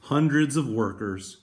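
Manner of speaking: lively picture-book reading voice; story read aloud. Acted. Teacher reading a picture book to students, lively. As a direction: read it brightly to the class.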